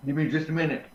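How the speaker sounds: talking around ice in mouth. Real, not acted. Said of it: "I've got ice in my mouth".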